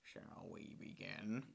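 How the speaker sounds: slow breathy low impression; slow, airy villain voice; Willem Dafoe voice. Shown as a demonstration. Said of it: "This is the same voice that I use for George Lucas and Jared Leto".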